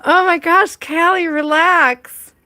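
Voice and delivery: high, loud laughing plea; excited. Real, not acted. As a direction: call out high through laughter. Laughing at her own hiccups.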